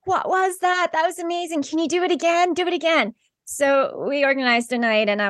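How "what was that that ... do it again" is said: high, eager pleading; thrilled friends. Retold or imitated. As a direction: beg for more, wide-eyed. Friends begging for a repeat.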